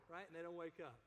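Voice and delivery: usual speaking voice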